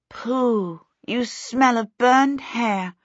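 high, drawn-out distaste; disgusted scolding. A narrator's reading. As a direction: stretch it out at a bad smell. Disgusted scolding after the fire.

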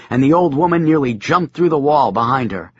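usual speaking voice

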